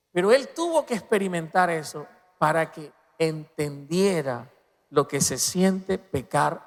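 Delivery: ordinary talk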